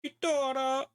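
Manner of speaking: very high, hammed-up Italian accent; over-the-top fake Italian accent; bad Italian accent. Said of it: "really bad Italian accent".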